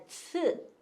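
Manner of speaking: 츠 breathed out as a voiceless ts; whispered ts. Shown as a demonstration. Teacher shows the ts ending sound of nuts. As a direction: hiss 츠 with no voice. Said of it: "츠 발음이 나요".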